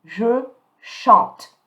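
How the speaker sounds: "Je chante" loud and separated, ending on a crisp T; sounded T. Shown as a demonstration. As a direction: model "chante" with T sounded, E silent. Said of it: "you're going to pronounce the T".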